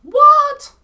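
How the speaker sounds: very high, loud shocked cry. Real, not acted. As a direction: yelp in disbelief. Shocked reaction.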